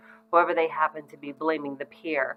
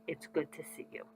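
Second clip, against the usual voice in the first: much softer, gentle and sincere; soft warm greeting; 13 dB quieter than usual. Shown as a demonstration. Parent greeting a child after a long day, soft and warm. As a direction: say it quietly as if you're glad they're home. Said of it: "I simply want you to say".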